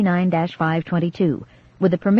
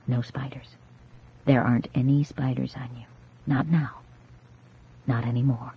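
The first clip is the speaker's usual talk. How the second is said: lower, much softer reassurance; calm her like a frightened child; soothing; 6 semitones lower, 5 dB quieter than usual. A narrator's reading. Carol comforting the shaken girl, low and soft.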